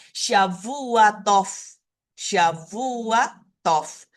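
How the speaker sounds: "Shavua Tov" said very slowly, each syllable drawn out; slow model phrase. Shown as a demonstration. Teacher slowly models the greeting for the class. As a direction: stretch "Shavua Tov" out for learners. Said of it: "Shavua Tov artinya have a good week".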